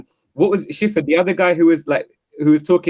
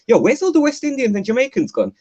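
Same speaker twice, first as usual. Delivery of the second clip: puzzled call-out; 9 semitones higher than usual. Retold or imitated. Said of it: "been asking people".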